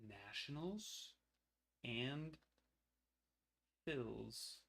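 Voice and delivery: Nationals drawn out softly and slowly with a rise. Shown as a demonstration. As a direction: murmur Nationals slowly to yourself.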